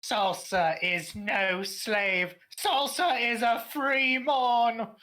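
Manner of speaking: slow, high, drawn-out declaration; hammed-up cry for freedom; mock proclamation. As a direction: proclaim it grandly, stretching every word.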